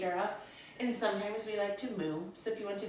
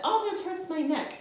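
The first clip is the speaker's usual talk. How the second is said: higher, louder mock whine of a sore student; complaining student; 11 semitones higher, 6 dB louder than usual. Teacher imitating a student complaining of neck pain, mock whine. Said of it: "I've heard them voice that".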